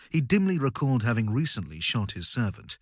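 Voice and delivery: usual speaking voice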